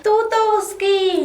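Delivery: slow, drawn-out jibe; taunting insult. Acted. First wife insulting her rival, taunting. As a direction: stretch each word to wound.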